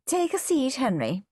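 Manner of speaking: lightly voiced doctor, high start falling away; high-pitched instruction dropping on the name; doctor character. A narrator's reading. Doctor's line in voiced story.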